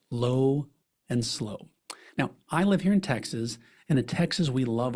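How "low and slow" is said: drawn out very slowly, each word lingering; low and slow. Shown as a demonstration. Speaker demonstrating brisket pacing to advisors, very slow. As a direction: stretch it like slow-cooked brisket. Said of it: "communicate like you cook a brisket".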